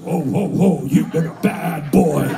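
low, far-off Santa voice; scolding Santa. Retold or imitated. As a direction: boom it low like a spooky Santa. Santa face scolds in hallucination.

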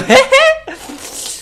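a sharp, very high squeal of laughter. Real, not acted. Laughs at the hair flick. (cười ré lên)

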